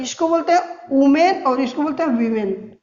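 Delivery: वुमेन with u, वीमेन with long ee; correct pronunciation. Shown as a demonstration. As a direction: contrast वुमेन against वीमेन clearly. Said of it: "नहीं, गलत है".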